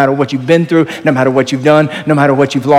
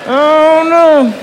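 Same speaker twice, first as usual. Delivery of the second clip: comic denial; 13 semitones higher, much slower than usual. Pretend. Pastor joking to the congregation about lost cats, high mock no. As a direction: play the cat joke with a mock-horrified no.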